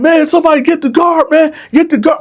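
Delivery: very high, fast, frantic; Dylan pleading for someone to get the guard; panicked plea. Retold or imitated. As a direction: beg someone to fetch the guard. Victim begging for guard.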